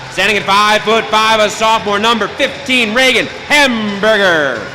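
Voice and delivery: milk each name for the crowd; arena announcer. Acted. PA announcer continues home lineup.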